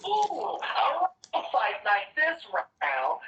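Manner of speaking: high, slow, gleeful goblin voice; excited goblin. Acted. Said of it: "The goblin says".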